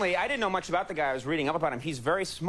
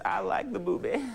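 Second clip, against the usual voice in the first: lightly voiced goofy Clinton; a dumb, raspy-free Clinton bit; Bill Clinton impression; 6 semitones higher than usual. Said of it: "the stupidest impression ever".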